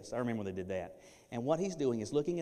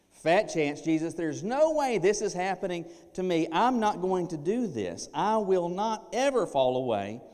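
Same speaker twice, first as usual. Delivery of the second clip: loud, higher, swaggering vow; boastful Peter; 7 semitones higher, 10 dB louder than usual. Acted. Preacher voicing Peter's loud boast to Jesus. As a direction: belt out a cocky promise. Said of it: "But Peter has said what?".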